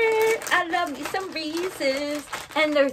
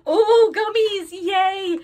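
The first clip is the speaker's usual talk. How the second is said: teary delight; 6 semitones higher, 7 dB louder, much slower than usual. Real, not acted. Finds gummies in the bag.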